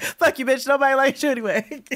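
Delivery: high, loud mock heckle; laughing mock street heckle; mocking insult. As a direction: squeal the insult with mock venom. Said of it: "Terrible, by the way".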